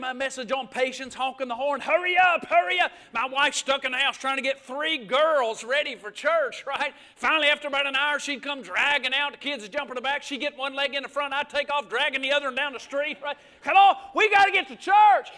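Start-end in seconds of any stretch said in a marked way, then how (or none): 1.8-2.9 loud impatient call to hurry; mock impatient husband yell. Honking horn, calling to wife. 13.6-15.3 loud, higher rushing shout; mock exasperated dad yell; impatient shout. Husband rushing family.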